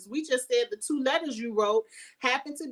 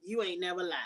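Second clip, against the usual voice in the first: soft echo; 5 semitones lower, 6 dB quieter, faster than usual. Real, not acted.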